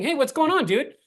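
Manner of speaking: casual bro greeting. Retold or imitated. Sales trainer showing his own bro-style phone opener. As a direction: greet like an old buddy. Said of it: "that's how I talk, right?".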